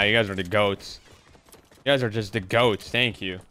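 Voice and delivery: usual speaking voice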